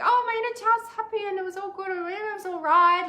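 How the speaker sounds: hammed-up breezy dismissal; mock breezy. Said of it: "sometimes people are like".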